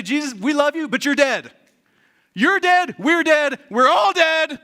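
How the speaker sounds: hammed-up alarm, hammering each phrase; panicked shouting. Disciples waking Jesus in the storm, panicked shouting.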